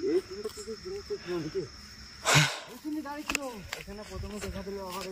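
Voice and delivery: frightened hush. Real, not acted. Frightened recount of sighting.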